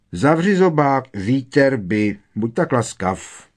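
mock-polite telling-off, starting high and loud; dismissive put-down. A narrator's reading. Fred tells his brother to shut up, dismissively. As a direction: shut him up, then feign courtesy.

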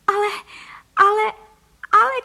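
high, loud repeated cries; loud, high-pitched exclamation; shouting. Acted. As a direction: cry out each word in mounting alarm. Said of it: "křičela paní Frejková v obličeji celá fialová".

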